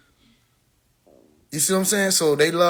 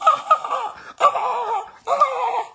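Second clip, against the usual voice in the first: high howling laugh. Real, not acted. Remembering the roast, howling laugh. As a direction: howl with laughter.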